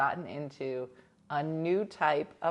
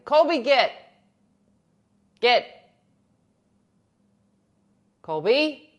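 much louder and higher, a firm command; calling out sharply; 7 semitones higher, 11 dB louder than usual. Real, not acted. Owner yelling at the dog to leave the window. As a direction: shoo the pet off the window.

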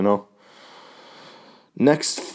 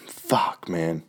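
whispered; 3 semitones lower, 7 dB quieter than usual. Retold or imitated. Lawler's resigned reaction retold.

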